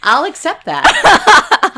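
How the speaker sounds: slow, high, laughing. Real, not acted. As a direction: laugh through it, drawn out. Laughing acceptance of mascot.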